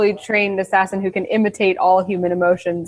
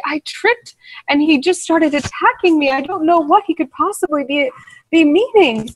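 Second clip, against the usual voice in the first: higher, rushed pleading to the guard; breathless plea; 7 semitones higher than usual. Acted. Thief pleading with the nearest guard, breathless and higher. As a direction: sell the fake fright, a little breathless. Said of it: "breathlessly and say".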